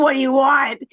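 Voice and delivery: imitated burst of exasperation; exasperated retort. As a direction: act out a fed-up caregiver's reply. Retold worn-out caregiver answering constant calls.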